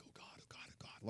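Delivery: very soft whispered pleading; whispered panicked prayer. Retold or imitated. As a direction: whisper it under your breath, pleading with God. Retold frightened prayer as preacher approaches.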